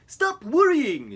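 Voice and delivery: slow, high, loud goofy moose; Bullwinkle voice. Acted. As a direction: drawl it out big and proud. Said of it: "said Bullwinkle".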